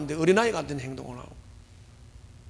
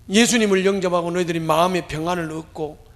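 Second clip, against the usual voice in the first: ordinary delivery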